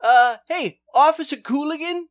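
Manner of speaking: spring the trap with mock innocence; sly Commish. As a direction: commish calling his officer in on the briber.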